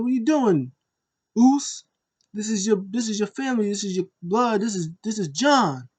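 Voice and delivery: imitated high, urgent plea; pleading. Brother appealing to family and blood, imitated pleading. Acting Jimmy appealing to Roman.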